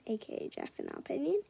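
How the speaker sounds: ordinary delivery, nothing marked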